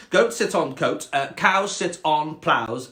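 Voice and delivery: slow, superior cat listing rules; snobby cat. Acted. Cat adding rules for the frog, snobby and slow. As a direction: recite the list snootily, drawn out. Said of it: "quite a snobby cat".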